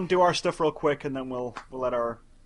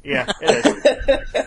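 laughing through the words; high airy laugh while talking; 9 semitones higher, 6 dB louder, much slower than usual. Real, not acted. Laughing agreement.

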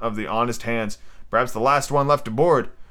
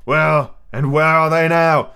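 put-on louder, higher-pitched old buccaneer; pirate ringleader, pointed; 5 semitones higher, 4 dB louder than usual. Silver reminds Israel of dead shipmates, pointed. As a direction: drive the lesson home as a pirate. Narrator voices Silver retorting.

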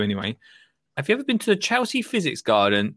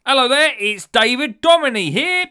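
much higher, louder announcing himself; booming caller; 12 semitones higher, 9 dB louder than usual. Pretend. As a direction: burst in loud over the last caller. Another fake caller bursts in.

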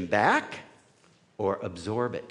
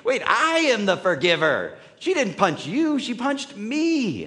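a higher, louder indignant outburst; indignant Randy; 7 semitones higher, 5 dB louder than usual. Acted. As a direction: protest as the one who got punched. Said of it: "Randy's like".

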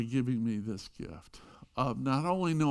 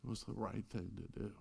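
humble answer; 4 semitones lower, 15 dB quieter than usual. Retold or imitated. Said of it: "And he said".

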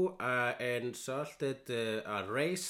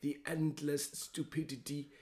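Werner Herzog musing; 2 semitones higher than usual. Retold or imitated. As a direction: muse on death like Herzog. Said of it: "He was saying something like".

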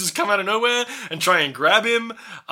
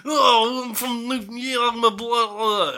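higher, a hint of Italian mobster accent; mild Italian mobster accent; 6 semitones higher, slower than usual. Shown as a demonstration. Explaining his voice to his co-host, a light mobster accent. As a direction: lightly do a mobster from New York. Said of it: "Italian mobster accent".

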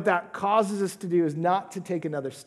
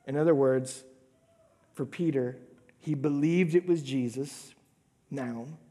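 ordinary talk

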